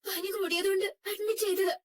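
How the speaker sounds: high, tearful crying voice; words breaking into tears. Acted. Wife telling the driver about her sick brother, crying. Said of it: "ഇങ്ങനെ അങ്ങോട്ട് കരയുന്നേ?".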